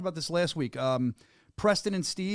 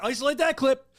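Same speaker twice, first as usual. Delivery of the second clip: louder, higher joking call; playful command; 8 semitones higher, 4 dB louder than usual. Real, not acted. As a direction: tease the crew to clip it. Joking to producers.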